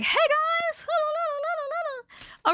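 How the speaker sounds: mock bursting in too chirpy and high; bubbly retold greeting. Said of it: "and I was like".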